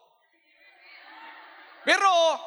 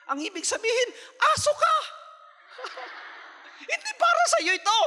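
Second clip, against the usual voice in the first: mocking dismissive voice. Preacher teasing congregation with harsh reply, high and mocking.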